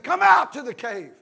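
loud summons after a hush; commanding call. Acted. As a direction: call him out firmly. God calling Elijah out, acted.

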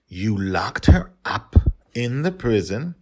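slow, stunned disbelief; father stunned his little girl jailed a princess; shocked question. A narrator's reading. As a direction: ask it as if you can't believe it.

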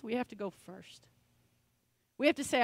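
ordinary talk

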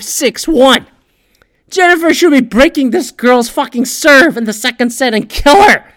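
high, loud furious rant at the match result; shouting at a sports report; angry rant. Retold or imitated. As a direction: rant at the screen like a betrayed gambler. Ranting at the article's score.

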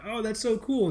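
mock admiration, pitched well up; impressed fans. Listeners gushing to the host about technical stuff, impressed.

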